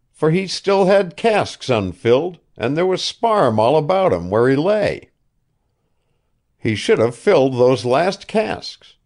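put-on an old man coaxing, talking up his pet ship; old ship owner Asa. A narrator's reading.

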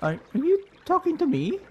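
high, hesitant character voice; Boy. Acted. Boy asking whether someone is talking to him, high hesitant voice. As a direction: pitch it up, unsure and questioning. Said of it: "I kinda forgot Boy's voice".

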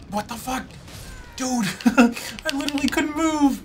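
high, loud laughing disbelief; shocked outburst. Real, not acted. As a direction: yelp it out, stunned and laughing. Bear stun-locked him.

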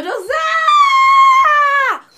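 piercingly high, loud, long re-enacted scream; crowd screaming. Crowd spotting the star, long re-enacted scream. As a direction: scream it out, holding the last cry. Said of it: "tava todo mundo numa gritaria".

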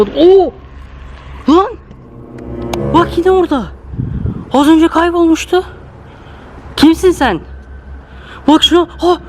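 sudden high startled cries. Real, not acted. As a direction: jump at the sight. Stranger suddenly reappears.

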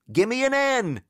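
high-pitched cheerleader chant; peppy, high cheerleading call; shouted cheer. A narrator's reading. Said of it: "shouted the cheerleaders".

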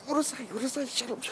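high-pitched, hushed plea to stop; hushed friend's hiss. Retold or imitated. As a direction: voice the panicked friend, high and soft. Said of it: "he says".

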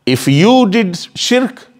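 ordinary talk, nothing marked

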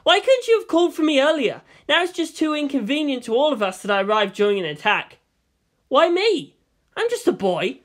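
put-on a scared boy pushing back at adults; anxious boy. Shinji protests being chosen.

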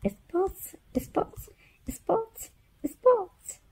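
playful squeaky voice; squeaky high spots. Acted. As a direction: squeak it for the baby.

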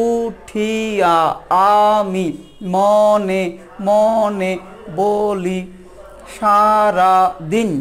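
slow verse with each syllable of উঠিয়া set apart. Shown as a demonstration. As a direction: read it slowly, beat by beat. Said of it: "এগুলো হচ্ছে মুক্তাক্ষর". (ছড়াটি ধীরে অক্ষর ধরে পড়া)

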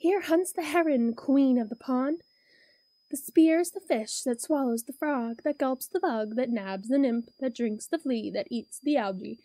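rhythmic building poem reading; reading to a toddler. A narrator's reading. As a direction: build the chant line by line. Reads cumulative pond poem.

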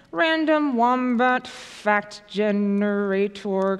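drawn-out, word by word; slow typing-along. Real, not acted. Presenter typing in the app description, very slow and drawn-out. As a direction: stretch each word as if typing it. Speaking slowly while typing it in.

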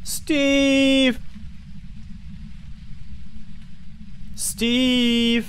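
shouting a name; calling out loud. Real, not acted. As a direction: call out loudly. Calling ghost in game.

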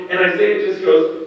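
ordinary talk, nothing marked

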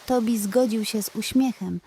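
ordinary delivery

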